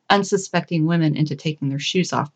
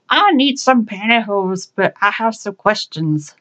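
higher, slow, badly faked old lady voice; bad old-woman impression; 3 semitones higher than usual. A narrator's reading. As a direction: fake an old woman's voice, unconvincingly, dragging the words. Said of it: "a young man doing a bad impression of an old woman".

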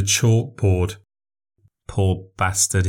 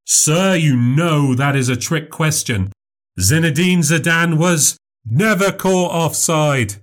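higher, bright showman voice performing for a crowd; showman memory act; 7 semitones higher than usual. Acted. Memorizer and heckler exchange.

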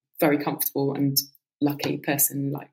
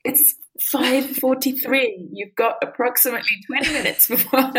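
robot voice; 8 semitones higher than usual. Retold or imitated. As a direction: imitate the talking clock announcement. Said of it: "if somebody just went".

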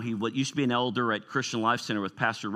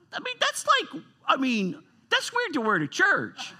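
very high, comic protest; incredulous squeal. Real, not acted. Preacher on skeleton pajamas at church, high-pitched incredulity. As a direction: squeak it out in amused disbelief.

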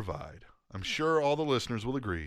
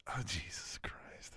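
whisper; 5 semitones lower, 15 dB quieter, slower than usual. Real, not acted. Exasperated aside during hiccup cure.

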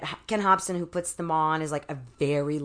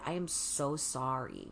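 quiet apology; 5 semitones lower, 11 dB quieter, slower than usual. Retold or imitated. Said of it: "He came over and he said".

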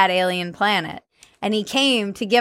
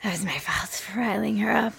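breathy unvoiced whisper; 8 dB quieter than usual. Real, not acted. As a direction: whisper it under the laughter. Whispered aside after laughter.

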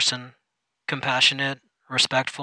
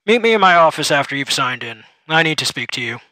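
boss voice; 7 dB louder than usual. Acted. Supervisor gives an order.

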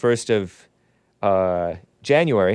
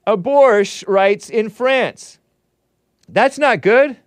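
mock outrage; 8 semitones higher, 4 dB louder, slower than usual. Mock outrage at French news.

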